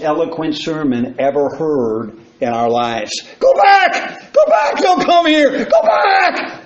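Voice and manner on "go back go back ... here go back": high, loud anguished pleading; a damned soul shrieking at the living; desperate scream. Acted. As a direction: scream it up from hell as a warning. Damned man preaches from hell.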